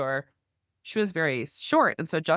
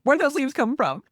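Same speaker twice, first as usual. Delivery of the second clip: loud, high-pitched exasperated demand; 11 semitones higher, 6 dB louder than usual. Retold or imitated. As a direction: act out an annoyed husband calling out. Husband's annoyed question retold.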